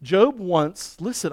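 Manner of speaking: ordinary talk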